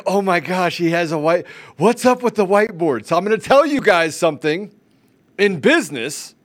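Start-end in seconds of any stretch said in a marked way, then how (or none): 0.1-3.1 higher, louder fake gasp; excited mock viewers. Host voicing fans amazed at the whiteboard, excited. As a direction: gush like a stunned viewer.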